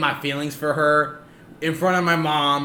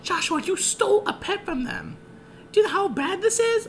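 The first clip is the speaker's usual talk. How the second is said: high mock scolding mom voice; imitating his scolding mom. Mom scolding her son, high mock-shocked voice. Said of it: "She's like".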